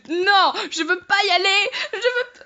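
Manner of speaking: half laugh, half cry, refusing to go; scared whimper. Real, not acted. Scared of the dark stairs, laughing whimper. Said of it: "on sent que j'ai peur".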